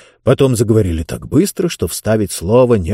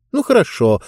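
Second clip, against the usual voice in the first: sharp; 8 semitones higher than usual. A narrator's reading. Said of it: "резко сказала Диба".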